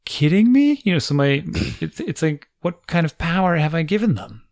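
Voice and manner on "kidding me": exasperated disbelief. Retold or imitated. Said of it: "I'm like".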